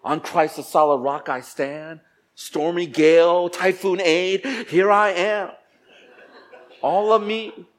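hammed-up proud declaration, high and loud; mock pious boast. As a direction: declaim it like a self-satisfied churchgoer. Boasting mockery of faithful churchgoers.

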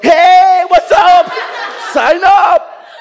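shouted high and loud, hammed-up leader begging; over-the-top cheerful plea; mock excited shout. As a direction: yell to get the group's attention. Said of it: "So they're like".

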